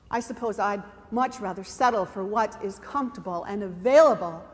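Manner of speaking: ordinary talk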